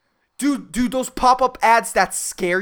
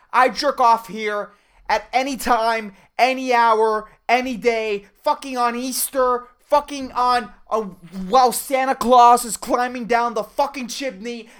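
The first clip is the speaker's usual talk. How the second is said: ordinary talk, nothing marked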